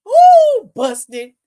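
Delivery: high, loud ooh, then busted; gleeful gotcha. Real, not acted. Host catching the couple out, gleefully teasing. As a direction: crow it, delighted to catch them.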